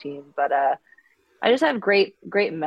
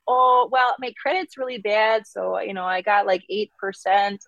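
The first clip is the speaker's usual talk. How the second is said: put-on mom's voice, higher Minnesota accent; breezy Minnesota mom admitting bad credit; 4 semitones higher than usual. Mom shrugs off eight percent, higher Minnesota accent. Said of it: "dial up that accent".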